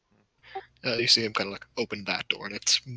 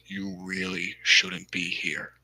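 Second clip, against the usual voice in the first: slow warning; 3 semitones lower, much slower than usual. Acted. Dwarf repeats the warning.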